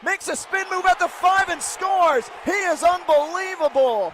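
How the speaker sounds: high, loud play-by-play shout; excited touchdown call. Real, not acted. Play-by-play announcer shouting a Notre Dame touchdown. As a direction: call the score like the crowd just erupted.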